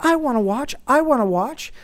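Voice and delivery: put-on high, rising little-kid whine; imitated demanding child. Son spotting watches in the mall, imitated demanding child. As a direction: pester like a small boy.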